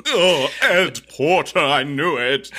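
high, loud, slow mock gasp of recognition; hammed-up dramatic reveal. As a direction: overplay the big reveal. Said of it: "That was pretty good".

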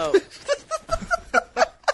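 high squeal of laughter. Real, not acted. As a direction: break into a squealing giggle. Loses it mid sentence.

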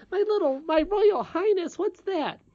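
put-on very high-pitched childish puzzlement; baffled. Princess querying her own title, baffled. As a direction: echo it like a bewildered little girl.

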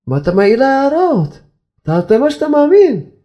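high, rising scolding; indignant rebuke. Acted. Rabbi reproaching husband for criticising his wife, indignant. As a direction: climb in pitch as you reproach.

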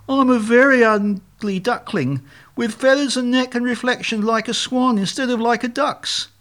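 high-pitched duckling lament; pitiful little bird voice, pitched high; woeful ugly duckling. Acted. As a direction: wail the wrong conclusion in earnest. Duckling reaches wrong, unhappy conclusion.

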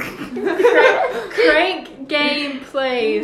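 high, slow, laughing crank; giggling fake stutter; laughing mock stutter. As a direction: laugh through the stammered crank. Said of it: "stuttering problem".

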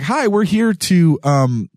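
hammed-up awkward rescuer; awkward hero voice. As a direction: play it stiff and awkward, slowly. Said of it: "I almost did a Southern accent for that".

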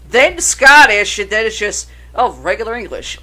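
ordinary delivery

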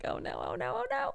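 hiding and panicking, mock squeaks; mock panic. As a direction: whimper the no's in hammed-up alarm. Hiding girl panicking, retold.

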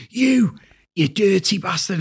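high, drawn-out accusing outburst; director's accusation. Retold or imitated. Director accusing his employee, acted indignant outburst. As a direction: imitate an irate boss laying into someone. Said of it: "he just fucking goes".

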